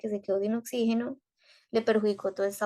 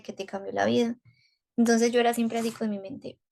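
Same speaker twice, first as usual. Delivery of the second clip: usual speaking voice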